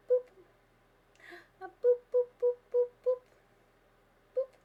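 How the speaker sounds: tiny high-pitched popping boops; playful boops. Pretend. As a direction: squeak out short, punchy boops. Playfully booping the cat.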